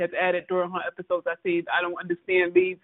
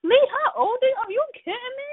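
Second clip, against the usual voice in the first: high, disbelieving scolding; incredulous; 13 semitones higher than usual. Retold or imitated. Scolding Lee for hating her sister, high and incredulous. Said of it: "I said".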